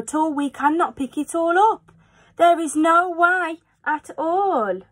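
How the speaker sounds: high, anxious fish voice protesting; a worried little fish crying no; fretful fish. Acted. Fish in the pot warning the children, high and anxious. Fish frets about the mess.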